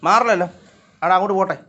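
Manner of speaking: high, loud call to move a cat; shooing call. Real, not acted. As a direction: shout the cat away. (പൂച്ചയെ ഉറക്കെ മാറ്റുന്നു)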